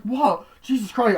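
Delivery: high, loud shocked cry; startled cry. Retold or imitated. Viewer reacting to the jump scare, loud shocked cry. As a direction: jump out of your skin.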